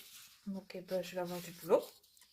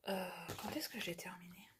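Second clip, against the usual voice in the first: whisper; 2 semitones lower, 11 dB quieter than usual. Real, not acted. Muttering to herself, unsure.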